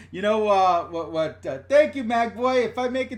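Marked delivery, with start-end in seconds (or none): none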